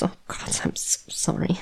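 soft, remorseful whisper; guilty whisper. Acted. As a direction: breathe the apology out. Guilty apology to the hurt human.

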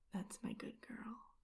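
soft voiceless whisper. Acted. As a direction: murmur it tenderly, no voice. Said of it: "You whisper in my ear".

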